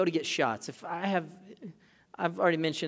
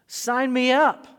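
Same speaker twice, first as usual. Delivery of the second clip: sarcastic eagerness; 8 semitones higher, 10 dB louder, slower than usual. Pretend.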